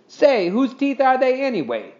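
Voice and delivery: sharp, insistent demand. A narrator's reading. Henry demanding to know whose teeth, insistent. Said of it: "demanded Henry".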